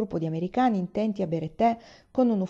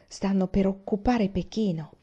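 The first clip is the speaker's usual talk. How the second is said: plain reading voice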